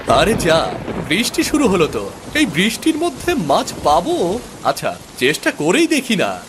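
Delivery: put-on high, restless young prince grumbling at the rain; a spoiled boy fishing in the rain and losing patience; boy's voice. (বৃষ্টিতে বিরক্ত ছোট রাজপুত্রের উঁচু গলা)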